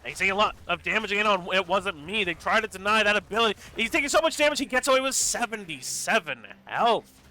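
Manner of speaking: fast, loud, rising in pitch; excited play-by-play. Real, not acted. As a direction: call the teamfight with building urgency. Intense fight play-by-play.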